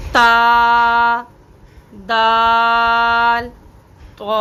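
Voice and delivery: त्वा drawn out, full and heavy; letter recitation. Shown as a demonstration. Teacher demonstrating letters slowly, drawn out. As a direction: hold each letter, त्वा thick. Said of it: "अब ता और त्वा".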